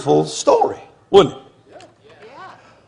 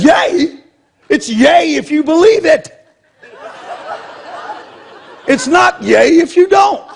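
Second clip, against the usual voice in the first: scoffing retort; 8 semitones higher than usual. Retold or imitated. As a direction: throw the word back sarcastically. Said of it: "He said".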